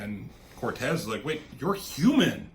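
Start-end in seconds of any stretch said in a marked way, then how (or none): 1.2-2.5 mock astonishment, rising higher and louder; acted-out stunned realisation; imitated shock. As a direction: react in disbelief, building on the second line. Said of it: "He's shocked".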